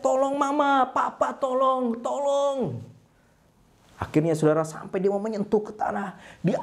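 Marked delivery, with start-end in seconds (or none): 0.0-2.8 high, drawn-out wail for help; cry for help. Acted. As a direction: play a falling chick calling its parents. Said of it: "Dia teriak". (teriakan minta tolong)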